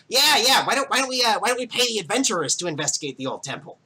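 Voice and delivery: high, bursting agreement easing into normal speech; Kemlin's regular voice. Acted. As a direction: chime in keenly to back an idea. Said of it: "in his regular voice".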